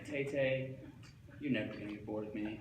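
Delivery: lightly whispered, soft reassurance; mock whisper. Driving home whispering to his imagined friend, soft hushed voice. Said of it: "I'm whispering to [name]".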